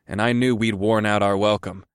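ordinary delivery, nothing marked

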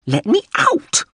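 very high little robot yell; trapped drone's cry. Acted. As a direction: cry out to be released, high-pitched. Trapped drone cries through the door.

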